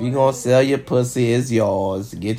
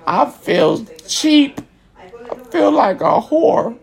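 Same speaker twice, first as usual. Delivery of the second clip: high, slow, hammed-up wounded voice; mock hurt; 9 semitones higher, slower than usual. Him pretending to feel cheap after being bought, high and slow. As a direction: whine it like a used man. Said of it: "I said".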